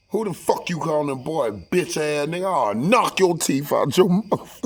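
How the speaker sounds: high, loud mock outrage; hammed-up heated comeback, slowing at the end; retold angry retort. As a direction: act out an indignant threat. Acted retort to being called boy.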